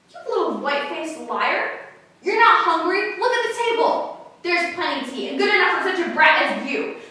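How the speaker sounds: loud, high angry shouting; furious acted scolding, voice thrown up; drunken father's tirade. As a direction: berate the child at full volume. Drunken father berates daughter.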